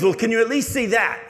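high and loud, urging him to see; excited girl. Retold or imitated. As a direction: press him eagerly, voice way up. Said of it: "She goes".

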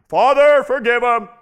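big high shout, drawn out to heaven; one loud cry. Shown as a demonstration. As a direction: bellow it as a single dramatic cry. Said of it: "he said it one time".

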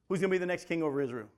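ordinary talk, nothing marked

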